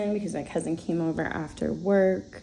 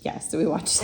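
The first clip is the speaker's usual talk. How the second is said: usual speaking voice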